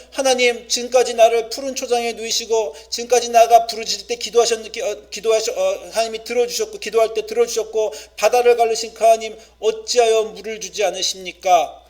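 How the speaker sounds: loud, high lament to God; crying out to God. Retold or imitated. Thirsty people lament to God, loud and pleading. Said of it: "라고 하면 탄식시예요".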